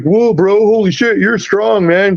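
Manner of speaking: imitating an awed golfer; mock impressed bro. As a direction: gush like a startled bro. Guy impressed after fight.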